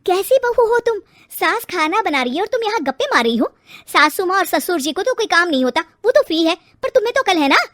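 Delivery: loud shrill-free rebuke, fast and sharp; angry scolding. Acted. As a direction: tear into the lazy bride. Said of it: "बुरा भला कहने लगती है".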